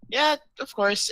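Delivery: put-on high, bright childish answer. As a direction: chirp it like a kid. Childish character agreeing brightly.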